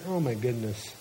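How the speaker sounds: low, quiet, weary groan of words; discouraged. Retold or imitated. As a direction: mutter it as a worn-down parent. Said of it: "Do you know how discouraging that was".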